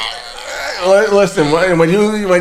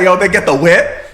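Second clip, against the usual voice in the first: normal talking voice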